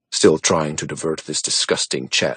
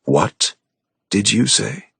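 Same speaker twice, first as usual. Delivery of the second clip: ice cold; slower than usual. Acted. Man stunned by an accusation of murder, ice cold. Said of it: "I went ice fucking cold".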